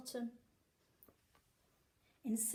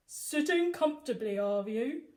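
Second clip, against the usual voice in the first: teasing policeman; 7 dB louder than usual. A narrator's reading. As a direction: savour the threat. Pugh taunts before fight.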